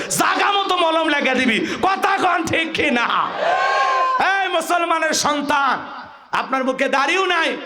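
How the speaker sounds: high, stretched-out sermon shout; full-force preaching. Real, not acted. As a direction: cry out to rouse the crowd.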